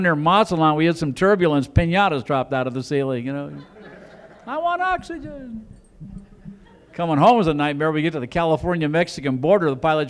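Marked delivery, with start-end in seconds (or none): 4.5-5.7 high, slow, thin plea for oxygen; mock panicky passenger. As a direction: whimper like a scared passenger.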